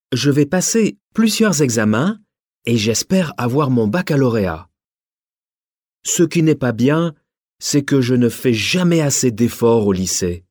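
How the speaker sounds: ordinary talk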